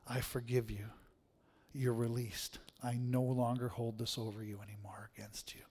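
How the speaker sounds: softly, fading into a whisper; soft forgiving words. Pretend. Preacher voices words of release to an offender, softly whispered. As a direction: speak the release gently, down to a whisper.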